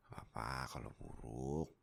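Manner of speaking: ordinary talk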